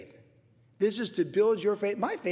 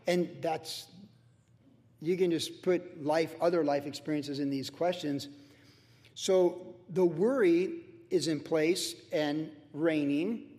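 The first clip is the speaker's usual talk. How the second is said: normal talking voice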